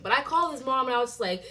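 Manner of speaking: ordinary talk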